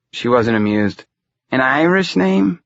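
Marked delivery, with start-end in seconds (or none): 1.5-2.6 put-on high, slow question in Romany accent. A narrator's reading. Unamused fortune-teller questions the young man, Romany accent. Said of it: "talked in a thick Romany accent".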